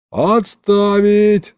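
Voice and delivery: long, stretched high holler; yelled command. A narrator's reading. Governor orders the brawling crowd to stop, yelling. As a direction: yell it out like an order on parade. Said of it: "заорал я".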